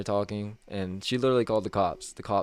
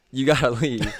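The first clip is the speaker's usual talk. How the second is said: louder, a laugh breaking into the order; laughing through quote; 4 semitones higher, 8 dB louder than usual. Real, not acted. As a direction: giggle midway through the words. Laughs while quoting boss.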